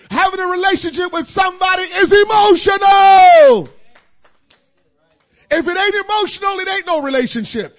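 loud, high preaching shout; preaching at full force. Acted. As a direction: whoop it to the congregation.

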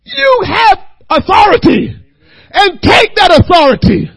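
loud, rising preacher's cadence; preaching. Acted. Preacher urging his congregation, loud and rising. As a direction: preach it with growing force.

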